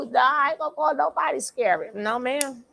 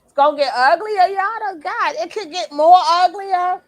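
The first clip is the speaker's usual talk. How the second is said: louder, higher hyped warning; 6 semitones higher, 9 dB louder than usual. Real, not acted. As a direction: relish the coming drama. Hyped at drama.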